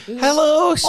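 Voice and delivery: high, loud put-on greeting; high, cheery mock greeting to the old boys; mock posh greeting. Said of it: "I'm like".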